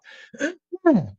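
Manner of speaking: loud sharp throat clear. Real, not acted. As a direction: clear the frog from your throat. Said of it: "excuse me as I cough".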